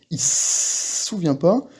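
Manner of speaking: "il se souvient pas" said slowly, leaning on the S of "souvient" with no breath; drawn-out demo of a tense S. Shown as a demonstration. Teacher slows down to show the tense S. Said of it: "le double S".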